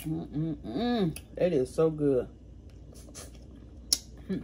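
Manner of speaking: eating hot food; talking while chewing crawfish; chewing with mouth full. Real, not acted. Seen 1: Eats crawfish at mouth, puffed cheeks chewing by 4.4, then reaches for water.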